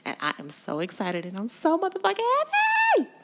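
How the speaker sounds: high, loud joyful yell; ecstatic shout. Real, not acted. Fan yelling about [name] coming to Miami, ecstatic. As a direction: burst out with pure joy.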